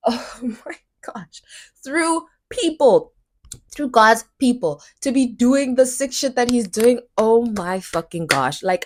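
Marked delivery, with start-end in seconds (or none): 0.1-4.8 overwhelmed near tears; breaking. Real, not acted. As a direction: voice collapsing into a whisper. Overwhelmed after laugh.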